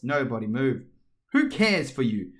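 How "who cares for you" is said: slow, louder defiant Alice. A narrator's reading. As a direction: dismiss her boldly. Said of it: "said Alice".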